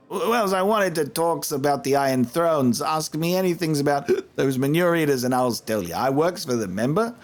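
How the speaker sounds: a hint of a scrappy street accent; Husam's rough accent. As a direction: informant greeting the mercenaries, rough accent. Voices Husam.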